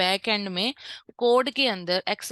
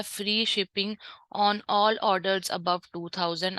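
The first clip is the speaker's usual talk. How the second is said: slow dictation; much slower than usual. Shown as a demonstration. Teacher dictates banner text.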